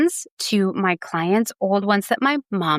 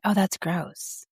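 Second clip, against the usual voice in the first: stunned revelation; 5 dB quieter, slower than usual. Retold or imitated. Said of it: "stunned revelation of going".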